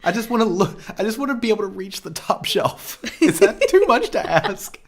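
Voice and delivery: high-pitched comic pleading; lively, high-pitched complaint; mock rant. Real, not acted. Comic rant about men's boot heels.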